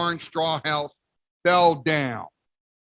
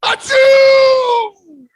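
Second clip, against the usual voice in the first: huge, high, loud sneeze; a giant shouted sneeze; great sneeze; 13 dB louder than usual. Acted. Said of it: "a great sneeze".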